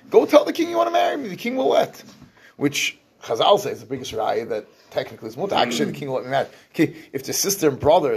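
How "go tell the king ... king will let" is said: high-pitched, hurried urging, retold; acted-out urgent advice, louder and much higher; urgent plea. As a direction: voice her pleading suggestion, pitch leaping up. Voicing her desperate plea to him.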